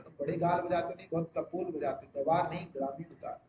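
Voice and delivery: ordinary talk, nothing marked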